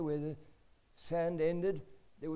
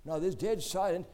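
ordinary delivery

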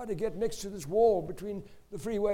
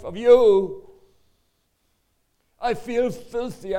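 anguished outcry; 4 semitones higher, 10 dB louder than usual. Retold or imitated. Colleague confessing his torment to Keith, loud anguished cry. Weeping colleague pours out condemnation.

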